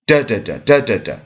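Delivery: DA da da, first da punched, two lighter; dactylic beat. Shown as a demonstration. Teacher sounding out the poem's meter, dactylic beat. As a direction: beat out da da da in falling threes. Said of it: "So we have strong, weak, weak".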